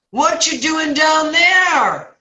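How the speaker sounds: very high, slow, loud holler; hammed-up long-vowelled call down; clueless passerby. Passerby questions man in the hole, hammed-up drawn-out shout.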